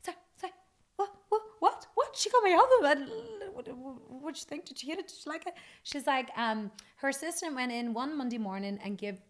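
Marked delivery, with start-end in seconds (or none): 0.0-5.5 high frantic stammered questions; flustered shock. Retold or imitated. Singer hearing [name] got her album, high frantic. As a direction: gush in panicked disbelief. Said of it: "I was like".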